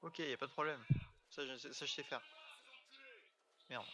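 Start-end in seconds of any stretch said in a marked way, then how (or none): none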